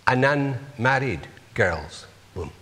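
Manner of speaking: usual speaking voice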